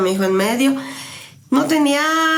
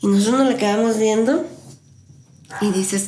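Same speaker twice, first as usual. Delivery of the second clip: ordinary talk, nothing marked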